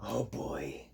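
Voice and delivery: breathy whispered groan. Retold or imitated. Face palm moment, whispered oh boy. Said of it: "you go".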